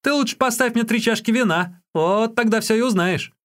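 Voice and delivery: high-voiced Юньге, driving a bargain; Юньге, cheeky bargainer. A narrator's reading. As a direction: pitch it high and keep it lively.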